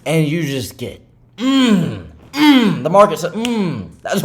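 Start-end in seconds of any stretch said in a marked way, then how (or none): 1.4-2.8 high, loud pained grunts; imitated groan. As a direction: grunt like taking a hit. Market hitting the trader.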